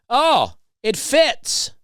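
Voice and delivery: high, slow, loud sing-song Oh it fits; loud pointed remark. Retold or imitated. To the rude lady nearby, a loud high sing-song. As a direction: drag it out loud so she overhears. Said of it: "I did loudly say".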